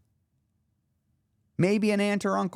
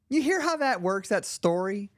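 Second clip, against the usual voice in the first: usual speaking voice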